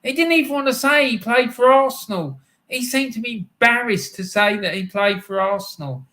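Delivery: high, loud, worked-up rant; heated rant. Real, not acted. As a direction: really let rip about the player. Ranting about a player he dislikes.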